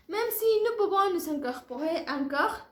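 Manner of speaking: normal talking voice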